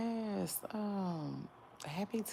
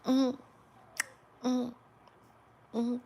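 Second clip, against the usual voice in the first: humming; 8 semitones higher, 9 dB louder than usual. Acted.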